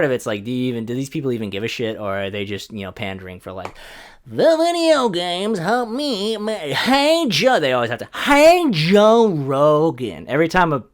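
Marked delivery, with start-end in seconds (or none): 4.3-7.6 high, drawn-out mock complaint; slow, loud mocking whine; whiny tweeter. Comic mimics gamers tweeting at Joe Rogan, high mocking whine. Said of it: "exactly the tone that I know". 8.1-10.3 high, loud, stretched-out mock greeting; whiny tweeter. Comic mimics how angry tweets begin, high whiny drawl. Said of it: "exactly the tone that I know".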